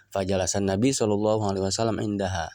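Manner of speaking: ordinary delivery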